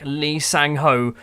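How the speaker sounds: ordinary delivery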